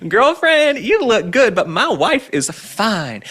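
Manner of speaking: high, loud sassy drawl, slowing at the end; mocking Barack Obama's new accent. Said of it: "doing this new accent where he's like".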